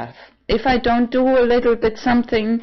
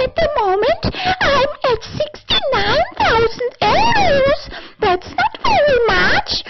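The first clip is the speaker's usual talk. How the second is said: squealed sales pitch, extremely high; high-pitched put-on voice. Vlogger advertising an apartment, squeaky high voice. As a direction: pitch it sky-high and hold it there. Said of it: "That's my voice".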